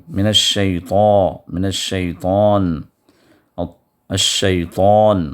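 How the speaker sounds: الشیطان with a full, heavy ط; الشیطان, ط pronounced thick and emphatic. Shown as a demonstration. Teacher stressing the heavy ط in الشیطان. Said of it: "طا کو جو پر کریں".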